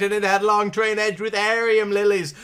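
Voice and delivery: usual speaking voice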